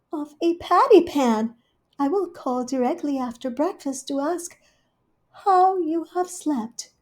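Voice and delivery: high, fast, loud solicitous cat; Rivy the cat, high and bustling; fussing hostess cat. Acted. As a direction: cluck over your guest. Rivy marvels.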